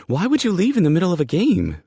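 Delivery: very high, loud incredulous question; frantic high-pitched outcry; alarmed disbelief. Acted. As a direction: demand an answer in a panic. Character alarmed he left the game.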